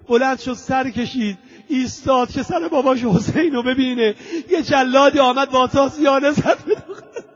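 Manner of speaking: grief-stricken narration cracking at the end; breaking into tears. Real, not acted. Preacher breaks down over beaten child.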